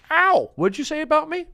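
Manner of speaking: very high, affronted outcry; yelp, then challenge him up high; mock indignant yelp. Skit character hit by a sound effect confronts someone, mock indignant yelp.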